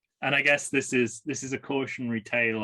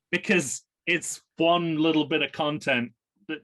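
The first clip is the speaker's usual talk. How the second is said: ordinary delivery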